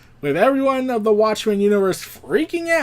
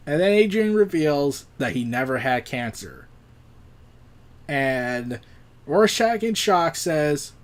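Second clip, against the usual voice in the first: ordinary delivery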